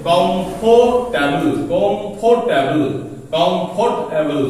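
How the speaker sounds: কমফোর্টেবল slowly, in three separate parts; syllable breakdown. Shown as a demonstration. Teacher demonstrates three syllables to students, slow syllable breakdown. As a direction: draw out each syllable of কমফোর্টেবল, repeating it. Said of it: "তিনটে এখানে আমার syllable হলো".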